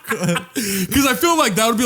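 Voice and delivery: high laughing through words; giggling as he explains. Real, not acted. Laughing while answering.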